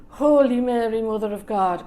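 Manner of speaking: loud mock-desperate invocation; panicked prayer. Bartender kneeling in church to hide, mock-panicked prayer. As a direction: blurt the prayer out, hammed up for laughs.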